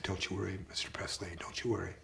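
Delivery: soft, unvoiced reassurance; whisper. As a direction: whisper comfort to a grieving father. Comforting the grieving father.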